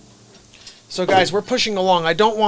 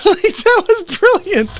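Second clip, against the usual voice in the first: high, squealing amusement; laughing; 4 dB louder, slower than usual. Real, not acted. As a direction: squeeze the words out through laughter. Cracks up at own bit.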